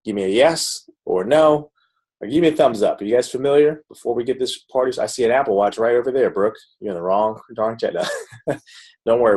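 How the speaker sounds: ordinary delivery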